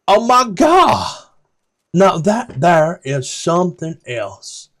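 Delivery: high and slow, gasping in awe; a wide-eyed guide marvelling at a statue; excited NPC voice. Acted. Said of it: "and goes".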